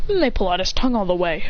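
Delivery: plain reading voice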